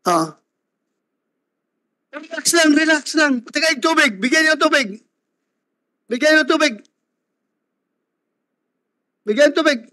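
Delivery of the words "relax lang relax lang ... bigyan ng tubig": high-pitched call for help; raised, insistent pitch over a commotion; urgent calling out. Real, not acted. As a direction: urge calm and call for water. Chair calls for water for upset person.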